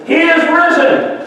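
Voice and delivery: high ringing proclamation shouted out; yelled out. Retold or imitated. Priest shouting over the communist speaker. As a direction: call it out over the crowd. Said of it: "he yelled out".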